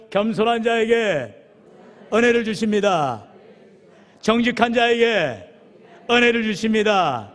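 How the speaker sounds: slow, deliberate phrases for the church to repeat; slow lead-in recitation. Shown as a demonstration. Pastor lines out words for congregation, slow and measured. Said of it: "같이 따라해볼까요".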